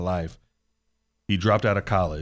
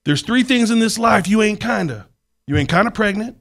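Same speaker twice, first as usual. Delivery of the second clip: much higher, louder preacher cadence; mentor's voice; 7 semitones higher, 7 dB louder than usual. Retold or imitated. Imitating his mentor preaching to him, high and loud. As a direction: hammer each ain't kinda line home. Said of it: "That was how he talked".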